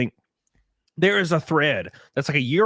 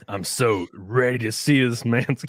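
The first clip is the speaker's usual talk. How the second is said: slow, breaking up with laughter; laughing; 4 semitones lower, slower than usual. Real, not acted. Host joking about the man's pictures, laughing. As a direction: struggle through it while cracking up. Cracking up over photos.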